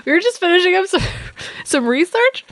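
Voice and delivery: squeaky voice cracking upward as he fakes an excuse; caught crying. Skeleton caught crying, squeaky voice fakes normal. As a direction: pitch shoots up, trying to sound normal.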